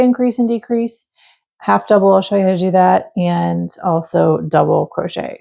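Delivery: ordinary talk, nothing marked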